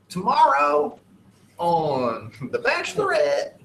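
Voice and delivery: high-pitched mock promo voice, drawn out; hammed-up announcer teasing the next show. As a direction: sell it like an exaggerated TV promo. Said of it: "Yeah, it's always like".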